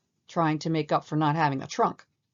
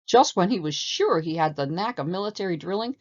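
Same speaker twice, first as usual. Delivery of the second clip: plain reading voice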